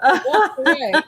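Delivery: breathless from laughing. Real, not acted. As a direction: still laughing as she talks. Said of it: "I was laughing so hard".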